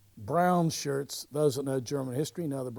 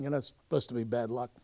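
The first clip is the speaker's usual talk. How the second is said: usual speaking voice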